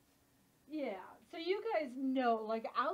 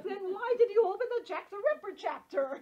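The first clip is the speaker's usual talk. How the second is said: loud, high comic protest; mock outraged question; 9 semitones higher, 9 dB louder than usual. Challenging someone over the Jack the Ripper chapter, mock outraged. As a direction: raise pitch and volume in playful disbelief.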